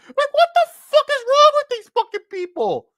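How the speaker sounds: real exasperated yell, pitched high; furious high shout. Real, not acted. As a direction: screech it way up in outrage. Furious rant peak.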